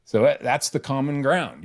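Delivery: ordinary talk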